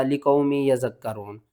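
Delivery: लिकौमी यज़क्करून quick, without holding the doubled letters; common misreading. Shown as a demonstration. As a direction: read लिकौमी यज़क्करून briskly, no stretch on the join. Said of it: "बहुत से लोग इसको पढ़ते हैं".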